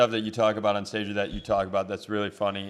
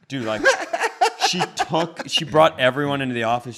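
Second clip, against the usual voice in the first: ordinary talk, nothing marked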